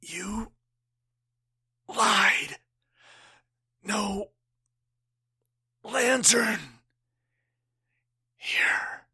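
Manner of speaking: fading, gasping, ending in a whisper; a dying man's fading gasps; dying words. Acted. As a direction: breathe each word out, dying.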